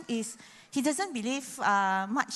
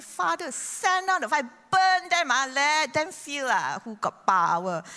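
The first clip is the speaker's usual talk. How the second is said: hammed-up higher, louder cry for fire; mock vengeful shout; 3 semitones higher, 4 dB louder than usual. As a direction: demand revenge, showing who has power. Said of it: "So if I'm on the cross".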